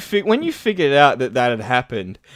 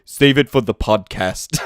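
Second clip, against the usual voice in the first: slow quoted line; 6 semitones lower, slower than usual. Retold or imitated.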